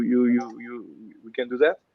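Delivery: ordinary talk, nothing marked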